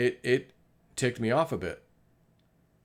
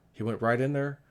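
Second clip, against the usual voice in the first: ordinary talk, nothing marked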